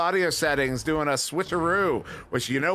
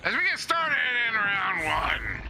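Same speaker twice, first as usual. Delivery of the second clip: gruff announcer growl; growly voice; 4 semitones higher than usual. Acted. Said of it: "Let me get a growly voice in early here".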